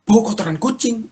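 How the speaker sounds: high-pitched incredulous question; astonished echo. Retold or imitated. Husband realises wife can smell cat mess, astonished high question. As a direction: echo it back high, suddenly amazed. Husband echoes wife's remark in surprise.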